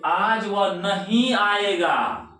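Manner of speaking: नहीं drawn out high and strong; slow the sentence and hit नहीं hard; stress on नहीं. Shown as a demonstration. Teacher demonstrating word stress to students, stress on नहीं. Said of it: "नहीं पर ज्यादा जोर पड़ा कि नहीं".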